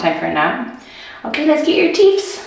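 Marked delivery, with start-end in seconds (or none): 1.2-2.5 put-on high sing-song baby talk. Mom talking to her baby.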